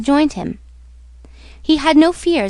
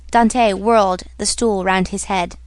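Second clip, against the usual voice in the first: plain reading voice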